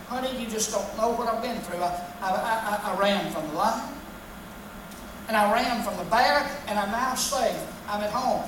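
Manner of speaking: low, soft worn-out voice; man sighing out his ordeal; exhausted man. Retold or imitated. A lightly voiced weary man telling his wife his ordeal. Man home after lion and bear.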